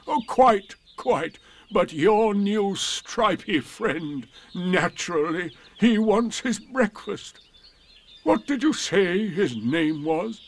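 high, slow, mournful donkey drawl; Eeyore, politely gloomy and slow. A narrator's reading. Eeyore voiced in story.

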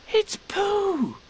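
very high, thrilled cry; excited. Acted. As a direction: burst out with delighted recognition. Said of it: "said Christopher Robin excitedly".

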